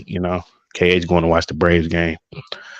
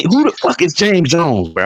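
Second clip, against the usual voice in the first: mock indignation; 8 semitones higher than usual. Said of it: "I was like ... I was mad".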